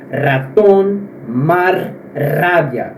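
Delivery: Spanish rolled r on "ratón, mar, rabia"; trilled R. Shown as a demonstration. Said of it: "ele é pronunciado de uma maneira vibrada".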